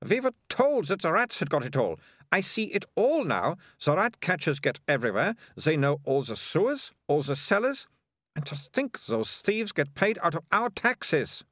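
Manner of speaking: lightly voiced, high outraged young girl; indignant girl. Melissa works out the rat catchers' theft, indignant. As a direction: rant about the thieves with righteous scorn.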